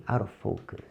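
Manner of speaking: softly repeated practice phrase; low voice. Shown as a demonstration. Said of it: "Repitam em voz baixa". (em voz baixa)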